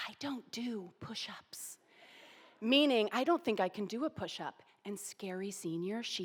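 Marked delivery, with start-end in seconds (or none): 0.1-1.8 hushed whisper aside to a friend; private whisper. Retold or imitated. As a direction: whisper it so the senior can't hear. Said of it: "privately say".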